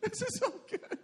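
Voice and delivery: high squealing laughter over the words; laughing through it. Retold or imitated. Preacher acting out binge-watching, breaking into high laughter. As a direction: squeal it out mid-laugh as if loving the show.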